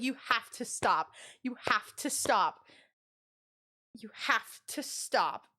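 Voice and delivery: slow, spaced-out command; exasperated insistence. Retold or imitated. Storyteller retelling how she told a teasing coworker to quit, slow exasperation. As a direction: stretch each word to shut the joke down.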